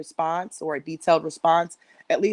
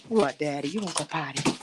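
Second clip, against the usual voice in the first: ordinary delivery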